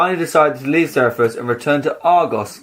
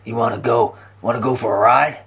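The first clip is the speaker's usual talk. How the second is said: put-on cowboy accent; 2 semitones lower than usual. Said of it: "that's more like New York".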